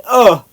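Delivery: loud, high cry of "oh" at a harsh sip; sudden high, loud recoil; retold shocked "oh". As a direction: yelp it out as the drink hits. Reaction to sipping straight liquor.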